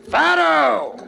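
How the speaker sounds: loud, high hail across a room; shout. Acted. Grigsby spots his old pal in the inn, shouting. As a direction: shout the name out as a greeting. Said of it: "and he shouts".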